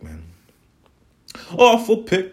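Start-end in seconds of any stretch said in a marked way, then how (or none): 1.5-2.3 awful pick blurted high and loud; "Awful pick" punched up high and loud; louder outburst. Real, not acted. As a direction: shout "Awful pick" in disgust.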